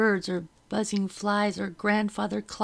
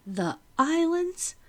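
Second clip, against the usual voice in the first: slow, much higher appalled question; horrified disbelief; 10 semitones higher, 4 dB louder, slower than usual. A narrator's reading. Narrator to Becky, dawning dread about the islands, aghast. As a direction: let the horror rise in the question.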